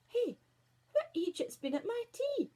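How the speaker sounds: high, squeaky indignant dwarf; grumpy dwarf Greety. Acted. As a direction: squawk it like a cross wee dwarf. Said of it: "speered the dwarf called Greety".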